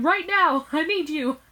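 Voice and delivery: put-on hurt girl pleads with her parents, high and loud; urgent plea.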